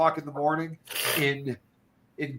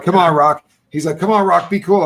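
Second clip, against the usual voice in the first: louder coaxing call; pleading urge; 8 dB louder than usual. Retold or imitated. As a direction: push him to come along, loudly. Friend urging him to party.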